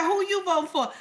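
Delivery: high, pressing question; auntie asking. Retold or imitated. Auntie at the polls pressing her niece, high insistent question. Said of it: "She said".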